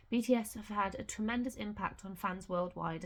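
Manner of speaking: ordinary delivery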